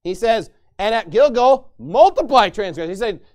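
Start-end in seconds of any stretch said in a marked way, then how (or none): 0.8-2.9 loud sarcastic dare on "multiply transgression"; "And at Gilgal, multiply transgression" thrown out loud and mocking. God daring the sinners to sin more, loud sarcastic mock. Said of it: "he's mocking them".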